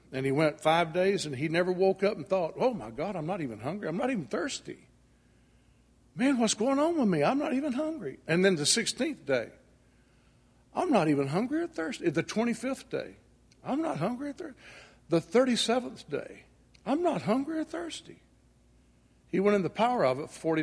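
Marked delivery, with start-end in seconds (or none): 2.6-8.2 amazed Elijah. Acted. Elijah's imagined amazed thoughts. 10.7-12.1 amazed Elijah. Acted. Elijah's amazed thought, day sixteen. 13.6-14.6 amazed Elijah. Acted. Elijah's amazed thought, day twenty-five. 16.8-18.2 amazed Elijah. Acted. Elijah's amazed thought, day thirty-seven.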